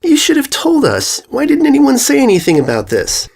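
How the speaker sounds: high, indignant filly outburst. A narrator's reading. Filly confronting her sister, indignant. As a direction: demand an answer, offended. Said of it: "shock to indignance".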